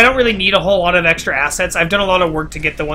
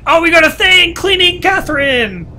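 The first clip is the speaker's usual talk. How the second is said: excited; 9 semitones higher than usual. Real, not acted. Finds an item in the game.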